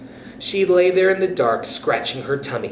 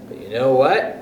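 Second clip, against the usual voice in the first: plain reading voice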